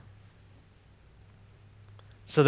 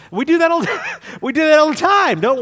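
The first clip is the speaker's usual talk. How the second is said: laughing high; 12 semitones higher, 9 dB louder than usual. Real, not acted. Laughs at common habit.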